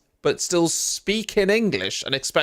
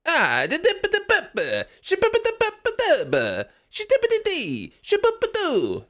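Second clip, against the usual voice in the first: mock Italian gibberish. Shown as a demonstration. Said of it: "You're like, just say the words".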